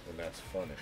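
ordinary talk, nothing marked

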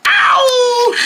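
sharp squealed ow; high pained yelp. Acted. Hit figure yelps in high pain. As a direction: yelp as if struck hard.

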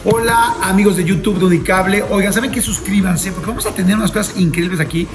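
loud, upbeat host pitch to subscribers; hyped channel promo read; promo announcement. Acted. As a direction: sell it to the YouTube audience.

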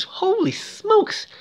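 high, slow, drawn-out "holy smokes"; amazed. Real, not acted. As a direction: gasp out "holy smokes" in delighted disbelief. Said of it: "narrator's amazed exclamation".

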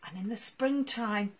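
usual speaking voice

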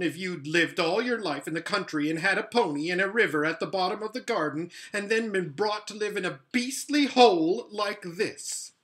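miserable. A narrator's reading. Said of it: "so miserable that he didn't care".

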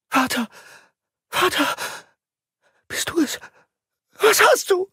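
high, terrified cries; screaming in fear. Acted. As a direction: cry out in horror. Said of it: "schrie sie entsetzt".

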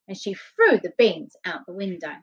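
ordinary delivery

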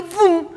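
loud, high "bum!"; sudden sound effect of a car swooping in; imitated whoosh-bang. (bum repentino, alto e agudo)

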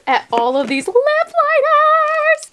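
high, slow squealed exclamation; excited squeal. Real, not acted. Showing viewers her lip liners, high excited squeal. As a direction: stretch it out in a delighted squeal.

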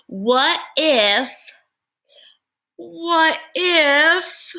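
high, near-crying nasal voice; nasal sneezy voice. Acted. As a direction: sound like a sneeze coming on. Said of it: "You see how I'm changing my voice?".

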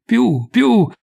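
high, goading repeat; urging. A narrator's reading. Narrator echoing the listener's egging-on, high and urging. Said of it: "lo aizzasse a dir più presto".

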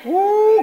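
high-pitched "ooh" of exultation; sudden high cry of praise; praise whoop. Real, not acted. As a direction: let out a high church whoop.

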